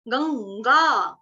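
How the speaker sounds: very slow तेषां, nasal ां; slow nasal teacher demo; nasal ending. Shown as a demonstration. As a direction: stretch तेषां with nasal end. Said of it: "ये वाला अनुस्वार उसके साथ जुड़ता है".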